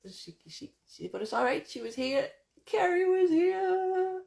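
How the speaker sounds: climbing high into a long, loud final squeal; excited exclamation. Real, not acted. Delighted that Carrie was there.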